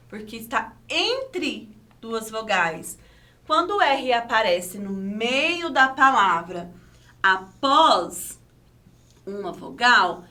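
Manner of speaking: ordinary delivery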